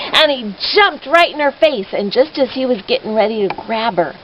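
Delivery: ordinary delivery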